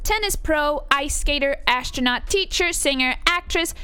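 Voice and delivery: vain American doll. Acted. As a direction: boast like a self-admiring fashion doll. Performs a doll's monologue.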